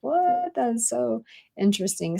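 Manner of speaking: a sharp high yelp of disbelief; surprised. Retold or imitated. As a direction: feign amazement, then let it drop. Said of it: "I'm like".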